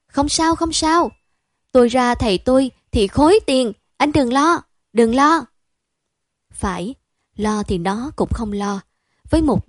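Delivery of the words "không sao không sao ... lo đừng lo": high, louder carefree boasting; breezy reassurance. Acted. Girl brags to calm her friend, high and breezy. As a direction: brush his worry off, airily. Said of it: "nói ba hoa".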